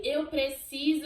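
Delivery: drawn-out loud cry of need; shouted plea. Retold or imitated. Wife in labor shouting at husband, loud slow plea. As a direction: shout it slowly, pleading. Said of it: "eu gritei".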